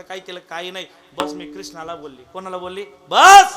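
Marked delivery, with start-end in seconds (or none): 3.1-3.6 high, loud sudden cry; woman cutting off the neighbours; sharp exclamation. Acted. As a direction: snap it out loud.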